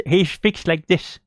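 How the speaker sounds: slowed-down mock of James. Shown as a demonstration. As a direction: drag the words out the way James talks.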